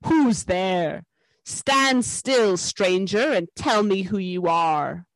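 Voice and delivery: a wolf's growled challenge, drawn out and forceful; growling wolf. A narrator's reading. Wolf guard challenges a stranger at the threshold, growling. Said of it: "said in a growling voice".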